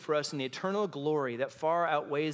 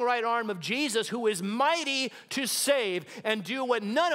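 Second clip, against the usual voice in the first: higher, louder preaching climax; fervent sermon climax; preaching at full force; 6 semitones higher, 4 dB louder than usual. Acted. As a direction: lift the room with it.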